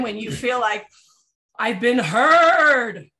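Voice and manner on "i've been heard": loud, very slow, stretched-out relief; "I've been heard" said loud and drawn out; relieved. Acted. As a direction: let it out like a weight lifted. Relief at finally being heard.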